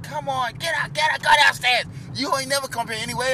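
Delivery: high, loud shouting uncle; uncle yelling. Retold or imitated. Uncle Tom chasing kids downstairs, loud high yell. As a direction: chase them downstairs, yelling. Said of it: "Uncle Tom, he's like".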